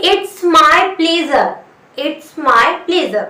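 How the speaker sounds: very slow, word by word teacher model; slow model phrase. Shown as a demonstration. Teacher spelling out a question for Hindi learners, very slow. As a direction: stretch every word so learners can follow. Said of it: "तो मैं क्या बोलूंगी".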